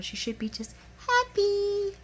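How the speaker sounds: "happy" sung out high and long; drawn-out happy. Real, not acted. Recapper wishes she'd be happy, "happy" drawn out high. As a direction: stretch "happy" in a high, sustained pitch.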